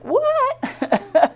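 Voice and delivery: high-pitched, stunned outburst; incredulous shock. Retold or imitated. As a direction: squeal it in comic disbelief, leaping far up in pitch. Acts out her shocked reaction.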